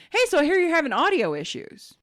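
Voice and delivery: imitating a nice support caller; friendly tech greeting. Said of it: "they're just in general nicer".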